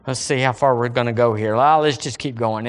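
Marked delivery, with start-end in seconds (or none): none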